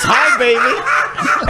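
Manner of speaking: very slow, drawn-out mocking coo; mock doggy coo. Mocking Mike's pet voice on a van, slow and drawn out. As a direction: stretch it like a creepy van loudspeaker. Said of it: "just his voice".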